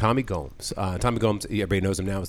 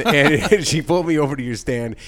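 normal talking voice

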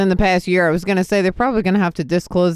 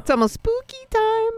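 spooky voice. Pretend. Spooky singsong announcement.

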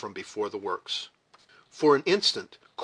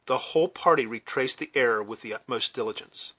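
ordinary delivery

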